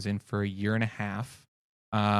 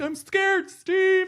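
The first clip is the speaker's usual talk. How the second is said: very high falsetto squeal; mock-scared nephew, squeaking high; frightened sidekick Chad; much slower than usual. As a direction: wail it in comic terror. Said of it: "he'd be like".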